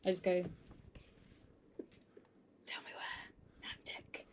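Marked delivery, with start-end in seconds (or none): 2.7-4.2 rasping goblin whisper in the ear; cold hissing layered whisper. Acted. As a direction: hiss it slowly, menacing goblin. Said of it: "rasping voice in their ear, little goblin voice".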